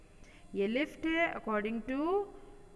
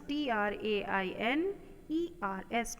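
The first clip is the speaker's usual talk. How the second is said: spelled letter by letter. Shown as a demonstration. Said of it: "spelling out the word".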